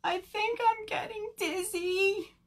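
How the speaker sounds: high-pitched tearful whine; woozy little bird wailing; crying wail. A narrator's reading. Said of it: "Bird cried louder".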